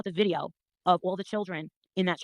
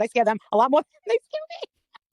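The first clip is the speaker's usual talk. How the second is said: laughing boast; 5 semitones higher, 5 dB louder, slower than usual. Retold or imitated. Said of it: "I said".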